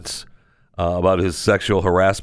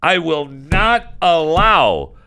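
hammed-up indignant declaration, higher and louder; mock outrage; 8 semitones higher, 3 dB louder than usual. Host vowing to protect ketchup shelves, in hammed-up outrage, higher and louder. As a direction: proclaim it like a defiant leader.

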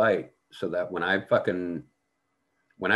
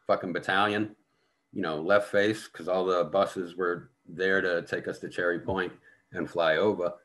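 ordinary talk

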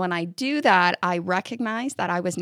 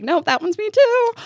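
mock dismay; 6 dB louder than usual.

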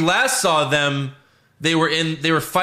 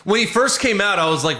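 ordinary talk

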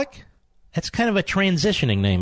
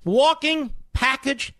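much higher, mock-incredulous voice; incredulous rant; 9 semitones higher than usual. Real, not acted. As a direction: pitch way up in scornful disbelief. Host's mock-incredulous rant at guest.

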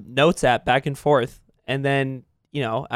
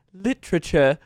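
"Literature." said in a posh English accent; clipped British "Literature."; 2 semitones higher than usual. Shown as a demonstration. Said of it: "in an English accent".

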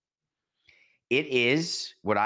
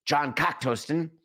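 Fletch quote; 6 semitones higher than usual. Retold or imitated. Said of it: "You hear me talk about".

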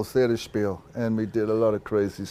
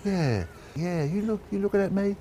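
put-on light South London accent, rising higher on the last words; 6 semitones higher, 4 dB quieter than usual. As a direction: imitate a cheeky South London lad, lightly. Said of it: "I started talking like that".

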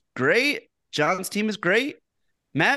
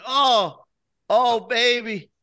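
excited; 6 semitones higher than usual. Real, not acted. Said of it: "hyping the Knicks' streak".

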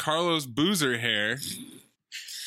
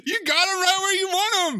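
high, louder yelling encouragement; urging the screen; 5 dB louder, faster than usual. Real, not acted. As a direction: egg the hero on, half laughing.

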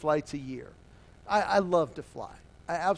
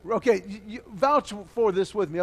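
ordinary delivery

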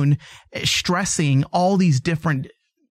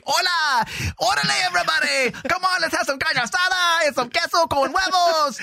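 mock Mexican accent; 14 semitones higher than usual. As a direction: play a cartoonish Mexican accent way up high. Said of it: "if you had a Mexican say".

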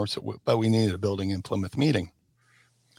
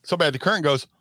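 ordinary talk, nothing marked

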